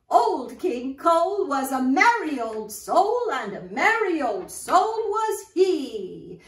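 put-on loud, sing-song reading to kids; nursery rhyme recitation. Storyteller reciting Old King Cole to children, loud and sing-song. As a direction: recite it big for a room of children.

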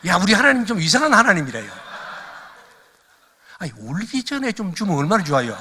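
loud, high comic grumble; mock exasperation. Preacher joking to congregation about God's late help, loud mock exasperation. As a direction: play up the grievance for laughs.

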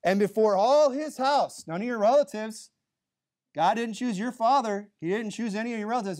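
loud, high acted retort; combative, emphatic argument voice; giving it right back. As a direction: hammer the comeback home. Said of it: "right back at her".

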